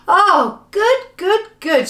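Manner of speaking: high, loud cheer; exultant repeated shout; delighted shout. Acted. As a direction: cry out with joyful relief. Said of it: "shouted everyone".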